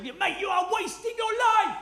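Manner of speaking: high, loud, furious ranting; ranting shout. Retold or imitated. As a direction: yell it like a music snob. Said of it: "I was literally on the table".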